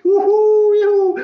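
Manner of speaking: high, drawn-out jubilant cheering; children shouting glory. Retold or imitated. Children praising God for the gift, jubilant cries. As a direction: imitate kids whooping in praise. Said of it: "os meninos começaram a dar glória".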